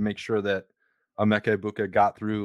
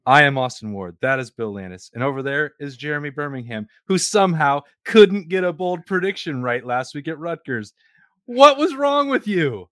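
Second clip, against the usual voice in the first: grand announcer; 6 semitones higher, 6 dB louder than usual. Pretend. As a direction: proclaim it to the whole empire, pitch soaring on key words.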